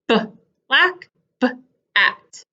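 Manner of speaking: B, pause, LACK, B, pause, AT; short isolated b before each word ending; sounded-out onset. Shown as a demonstration. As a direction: break b off from lack and at. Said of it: "They both say b".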